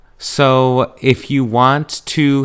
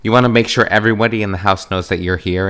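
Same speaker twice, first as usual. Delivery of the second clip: usual speaking voice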